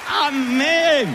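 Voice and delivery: shouted high amen; gritar amén. Shown as a demonstration. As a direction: call out amen to the church. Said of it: "Alguien tiene que gritar". (grito de amén)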